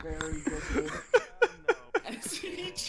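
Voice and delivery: big hall echo. Real, not acted. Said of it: "You sound really echoey".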